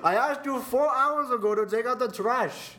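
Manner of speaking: put-on nagging Indian parent accent. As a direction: scold about the trash in an Indian accent. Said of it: "make fun of my parents' accent".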